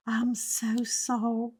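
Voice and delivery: whispered, slow and soft; mock whispered apology. Mimicking the girl's sugary apology, whispered. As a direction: breathe it out in a fake-sweet whisper. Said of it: "you should have heard her".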